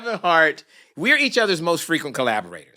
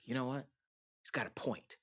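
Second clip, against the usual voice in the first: much softer, musing to yourself; inner thought; 3 semitones lower, 18 dB quieter, faster than usual. Retold or imitated. Producer convincing himself to go, in a soft aside. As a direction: think it aloud under your breath.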